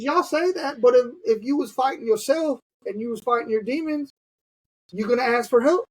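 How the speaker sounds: high, pressing challenge; challenging the room. Retold or imitated. Chris challenging the officers, high and pressing. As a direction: push the question up hard at them. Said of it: "He says".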